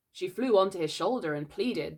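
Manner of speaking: plain reading voice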